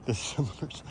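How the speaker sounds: lisping slightly from breathlessness. Real, not acted. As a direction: catch a lisp on the s. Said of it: "I'm lisping".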